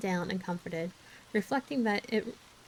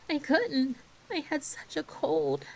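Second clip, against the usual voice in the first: higher and slow, on the edge of tears; lightly voiced tearful girl; 6 semitones higher, slower than usual. A narrator's reading. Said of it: "Anne dissolved into tears".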